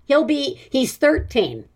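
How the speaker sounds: usual speaking voice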